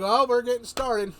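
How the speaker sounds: eager cry that the fight is on; gleeful battle cry. Retold or imitated. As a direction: shout it as the fight kicks off. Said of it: "And I'm like".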